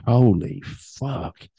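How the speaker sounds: drawn out slowly in disbelief; stunned holy fuck. Retold or imitated. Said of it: "And I go".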